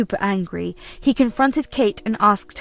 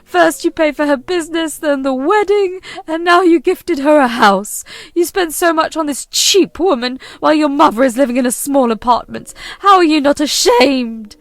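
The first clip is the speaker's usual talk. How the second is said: higher and louder, accusing the son; angry mother-in-law; 5 semitones higher, 7 dB louder than usual. Acted. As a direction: spit out every insult at the son's wife. Kate accuses son, narrator voices.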